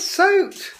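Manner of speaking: emphatic soaked. Real, not acted. Repeats soaked about the rain.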